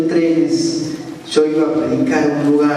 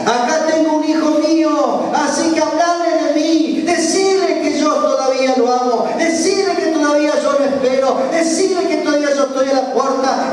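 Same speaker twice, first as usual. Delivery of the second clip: high, urgent preaching as God; impassioned proclamation; 9 semitones higher than usual. Acted. God sends the pastor to His son, impassioned proclamation. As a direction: cry out each command in a raised pitch.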